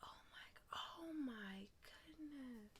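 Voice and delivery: breathless whisper of wonder; hushed, unvoiced disbelief; awed whisper. Real, not acted. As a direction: whisper it like it might break. Whispered awe at book.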